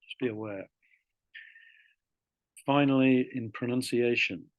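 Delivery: ordinary talk, nothing marked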